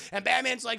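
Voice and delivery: ordinary talk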